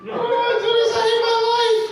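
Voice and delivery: high whiny crying voice; mock crying man. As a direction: imitate a grown man sobbing in falsetto. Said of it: "And then he just started crying".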